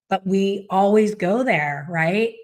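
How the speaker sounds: ordinary talk, nothing marked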